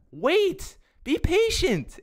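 high-pitched urging; high pleading. Real, not acted. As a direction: squeak out a plea for patience. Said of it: "scolding viewers".

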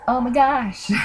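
a scandalised oh my gosh; mock shock. Reacting to shocking couple.

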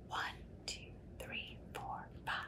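soft breathy count under the breath; whispered counting. Acted. Reading to a child, counting apples in a whisper. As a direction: count quietly like a secret.

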